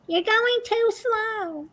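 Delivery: very high, slow whiny complaint; complaining bingo player. Retold or imitated. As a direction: squeak out a grumpy protest.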